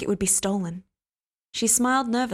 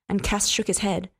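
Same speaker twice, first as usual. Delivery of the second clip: ordinary delivery, nothing marked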